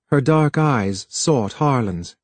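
ordinary delivery

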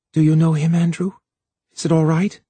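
plain reading voice

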